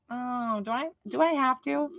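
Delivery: put-on small, high voice of a balky child; defiant child. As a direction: whine it quietly like a child resisting an order. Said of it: "like that defiant child that is like".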